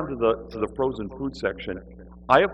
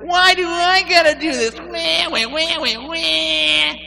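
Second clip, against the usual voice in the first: very high, loud mock whine; whining; 7 dB louder, slower than usual. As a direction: wail it out, dissolving into wah-wahs. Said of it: "I was so busy whining".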